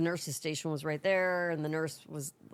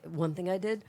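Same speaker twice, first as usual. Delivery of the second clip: ordinary talk, nothing marked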